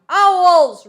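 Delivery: loud, high victorious yell; boy shouting triumphantly at the foreman. A narrator's reading. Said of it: "Roy proclaimed triumphantly".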